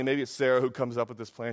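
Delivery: ordinary talk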